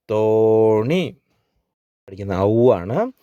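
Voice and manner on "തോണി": തോണി with a very long, held oo; long vowel. Shown as a demonstration. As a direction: slowly stretch the first vowel of തോണി. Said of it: "ഒരുമിച്ച് പഠിക്കാം". (തോണി എന്നതിലെ ഓ നീട്ടി)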